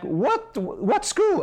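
high-pitched mock yell, no real volume; light, high imitation of shouting; retold shout back. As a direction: imitate someone calling from a boat. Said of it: "they shout back".

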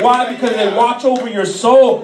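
loud, driving sermon delivery; impassioned church exhortation, projected loud; full-force preaching. Real, not acted. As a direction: preach it hard, punching each phrase loudly.

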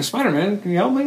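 high-pitched imitation of a plea; mock appeal for help, pitched high; acted plea. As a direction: quote her pleading, voice pitched well up. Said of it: "she's like, you know".

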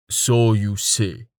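slow doubting stoic; dry skeptical. Acted. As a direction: drawl it with doubt. Tallheart doubtful.

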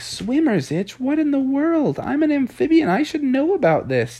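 high, incredulous frog voice; baffled disbelief. Acted. Amphibian frog hears about swimmer's itch, high baffled disbelief. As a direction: question it in a high voice.

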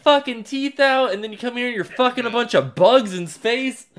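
high, loud ranting accusation; furious Mark. Retold or imitated. Mark ranting at his father, high furious yell. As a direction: tear into him. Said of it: "He's like".

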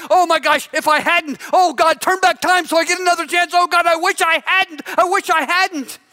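high, pleading cry to God; desperate wish to undo it; anguished regret. Acted. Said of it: "chased by demons of regret".

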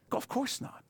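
whispered; hushed aside; whispered answer. Shown as a demonstration. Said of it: "answering his own question".

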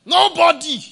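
high, loud preacher's cry; loud call. Real, not acted. Preacher calling out to the silent congregation, loud cry. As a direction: belt it out to the whole church.

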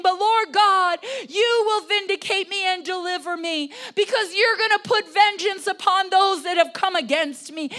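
loud, high, fervent plea; crying out to God. Acted. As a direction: call on God with full voice. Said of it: "he starts crying out to God".

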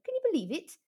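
ordinary delivery

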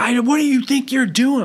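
high-pitched imitation of an irate daughter; mock screaming. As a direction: mimic her outburst high, without full volume. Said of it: "she literally starts screaming at me".

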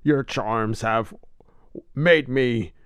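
slow, stagey Batman voice; Adam West impression. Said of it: "I try to do my Adam West".

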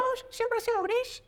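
very high squeaky dog voice. Acted. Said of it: "Me encanta tu voz de perro".

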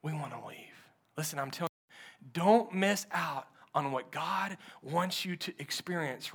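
low, hushed sermon voice drawing the church in close; hushed preaching. Acted. Pastor hushing the congregation about wanting to quit, hushed preaching. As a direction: preach it softly, almost confiding.

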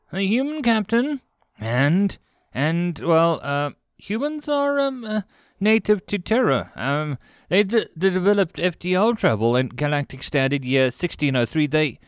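stammering eager junior officer; eager apprentice voice. A narrator's reading. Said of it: "eager to show off his knowledge".

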